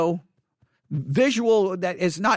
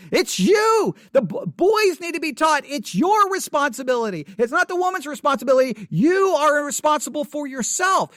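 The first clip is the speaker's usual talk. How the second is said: forceful preaching; 6 semitones higher than usual. Real, not acted.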